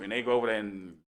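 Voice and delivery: ordinary talk, nothing marked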